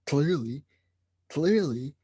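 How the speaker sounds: ordinary talk, nothing marked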